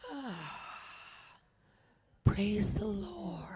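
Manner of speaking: slow breathy whisper of thanks; whispered praise. Real, not acted. Preacher whispering thanks to God after calming himself. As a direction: breathe it out slowly, relieved.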